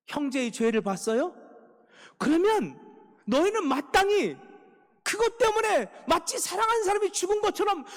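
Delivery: impassioned sermon climax, shouting; fiery preaching. Acted. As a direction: preach it at full force, rebuking the church. Preaching at full force.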